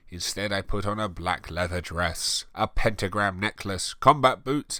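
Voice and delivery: ordinary delivery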